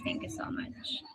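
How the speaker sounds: soft whisper; hushed grateful whisper; whispered thanks. Real, not acted. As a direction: breathe the thanks under your voice. Quiet thanks to a viewer.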